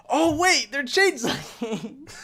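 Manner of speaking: loud, high gasp of realization; sudden realization. Retold or imitated. Viewer reliving the plot twist to a co-host, astonished. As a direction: blurt it out, high and stretched as it dawns.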